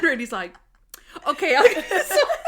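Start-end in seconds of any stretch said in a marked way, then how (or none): 1.3-2.4 high, louder eager voice with a laugh; eager Randy. Retold or imitated. Randy answering his boss, high and eager. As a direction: chirp it eagerly.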